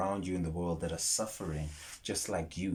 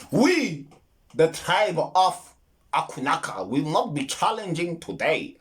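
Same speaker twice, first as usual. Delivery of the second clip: put-on higher, louder, slow pompous accent; mock Wakandan accent; 8 semitones higher, 7 dB louder than usual. Mocking the movie's tribe, pompous Wakandan accent. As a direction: proclaim it like a film tribesman. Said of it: "We don't speak like that".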